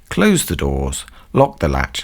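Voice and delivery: ordinary talk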